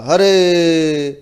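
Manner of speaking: हरे with the final ए held very long and slow; drawn-out hare. Shown as a demonstration. Teacher calling हरे long to show pluta. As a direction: stretch हरे out over three beats. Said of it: "जब उसको देर तक कहते हैं".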